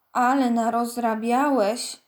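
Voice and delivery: ordinary delivery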